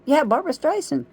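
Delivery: a sudden burst of recognition; excited exclamation. Real, not acted. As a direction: shoot up high and loud on the name. Correcting herself with a sudden exclamation.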